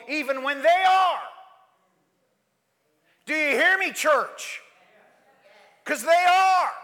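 loud, high preaching; fired-up sermon climax; full-force preaching. Real, not acted. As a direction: drive it home to the church.

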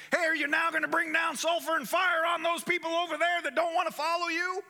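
high, loud eager disciple voice. Acted. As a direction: cheer on the fire from heaven, gleeful. Disciples eager to call fire.

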